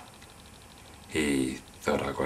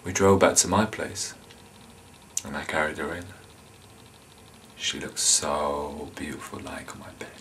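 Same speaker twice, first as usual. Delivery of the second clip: very soft, low near-whisper; hushed, creepy confession; 4 semitones lower, 11 dB quieter than usual. Acted. Captor recalling watching her sleep on his bed, creepy hushed murmur. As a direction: murmur it like a dark secret.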